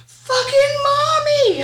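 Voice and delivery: high, drawn-out cussing scream; screaming toddler. Retold or imitated. Mom imitating her son cussing at night, screamed. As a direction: imitate a small child's loud, high wail. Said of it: "my son starts screaming".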